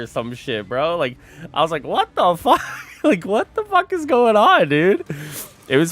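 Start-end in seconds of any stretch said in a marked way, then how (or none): 1.8-5.0 baffled. Retold or imitated. Retelling his baffled reply.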